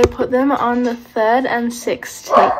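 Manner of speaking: talking with cheek pulled back; finger pulling cheek. Real, not acted. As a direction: mouth stretched by finger. Seen 1: Finger hooks mouth corner, stretching cheek to show braces, 0.5–3.0s.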